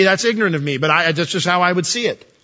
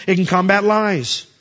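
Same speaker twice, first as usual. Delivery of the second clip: normal talking voice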